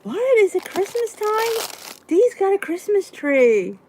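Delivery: high, drawn-out mock astonishment; mock surprise. Viewers spotting her Christmas tree, mock surprised. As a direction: play the viewers gasping at the tree. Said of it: "They'll be like".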